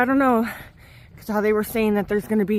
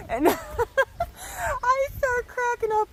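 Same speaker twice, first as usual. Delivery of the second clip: high-pitched, real laughter through the words; laughing; 14 semitones higher, much slower than usual. Real, not acted. Hiker retells the funny bet to viewers, laughing. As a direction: crack up while telling it, words slowed by laughing.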